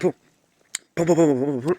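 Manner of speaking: sudden booming chant; playful loud repetition. Pretend. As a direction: belt out the word over and over. Loud filler repeated before signing off.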